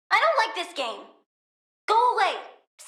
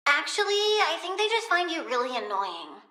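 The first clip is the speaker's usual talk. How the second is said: ordinary talk, nothing marked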